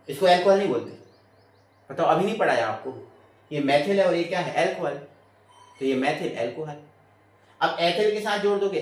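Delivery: usual speaking voice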